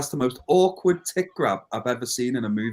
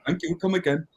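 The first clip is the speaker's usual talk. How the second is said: higher shopkeeper catchphrase voice; a light Apu impression; 6 semitones higher than usual. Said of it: "sounding like a poo from The Simpsons".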